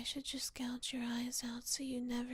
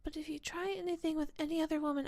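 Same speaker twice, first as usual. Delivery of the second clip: fast warning; faster than usual. Acted. Jealous warning to partner.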